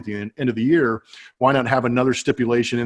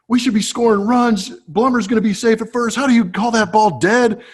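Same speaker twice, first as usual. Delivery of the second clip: higher, raised voice arguing; mock angry argument; 9 semitones higher, 3 dB louder than usual. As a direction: plead the case to the umpire, heated. Manager arguing with umpire, retold.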